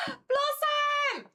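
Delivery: a gasp then a very high, loud cry of the name; excited squeal. Real, not acted. Streamer greets a new follower, squealing. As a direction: squeal with surprised delight.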